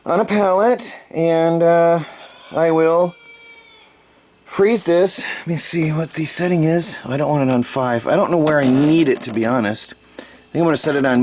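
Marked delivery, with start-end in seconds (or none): none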